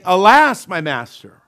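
"Alas" cried out high and loud, then "my master" drops; panicked exclamation. Shown as a demonstration. Preacher acting the panicked servant crying alas. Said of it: "read it with a little excitement".

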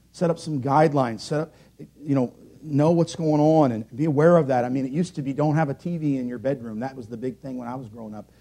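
ordinary talk

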